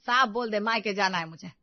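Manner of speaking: ordinary delivery, nothing marked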